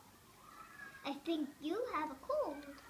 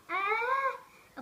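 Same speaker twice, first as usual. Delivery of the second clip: sneeze wind-up; 6 semitones higher, 7 dB louder than usual. Acted. Building up to a sneeze.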